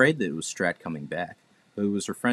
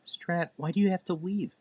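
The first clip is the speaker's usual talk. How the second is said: high little pleading voice; sweet cherub voice, hurt; 11 semitones higher than usual. A narrator's reading.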